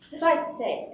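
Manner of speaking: usual speaking voice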